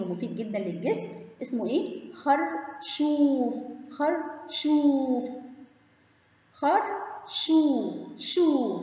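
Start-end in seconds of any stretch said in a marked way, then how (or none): 2.1-5.3 "خرشوف" very slow, oo stretched out; long oo. Shown as a demonstration. Teacher naming the vegetable for pupils, "خرشوف" with long oo. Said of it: "سامعين صوت المد بالواو؟". 6.5-8.8 "شو" stretched as a long shoo. Shown as a demonstration. Teacher letting pupils hear waw, "شو" drawn out long. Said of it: "سامعين صوت المد بالواو؟".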